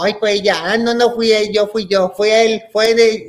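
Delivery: put-on high, overlapping-style kids' accusations; squabbling blame. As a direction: act out children passing the blame. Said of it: "se empiezan a echar todos la culpa".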